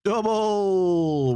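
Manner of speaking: high, drawn-out cheer of Double!; excited callout. Real, not acted. Streamer cheering a double loot for chat, high excited shout. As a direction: celebrate the double, pitch up.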